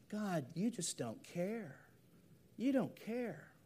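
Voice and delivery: very soft, slow-starting complaint; quiet reproachful prayer. Acted. Believer praying to God in doubt, quiet reproach. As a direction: murmur it to God as a hushed accusation.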